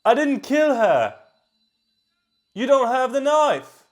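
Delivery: loud, high, hammed-up British accent; over-the-top British protest at full volume. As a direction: put on a shouted British accent, stretched out. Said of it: "I haven't done my British accent yet".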